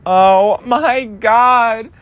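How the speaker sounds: high, drawn-out exasperated cry; wailed dismay, long and high. Retold or imitated. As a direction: stretch it out loud in mock despair. Retold reaction to being taken in.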